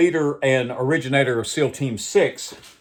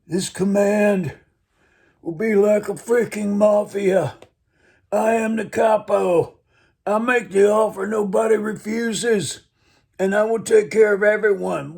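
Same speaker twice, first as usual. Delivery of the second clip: Godfather Brando voice; 10 semitones higher, 3 dB louder than usual. Retold or imitated. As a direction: play the Brando Godfather, hammed up and high. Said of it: "slipped into my Godfather mode".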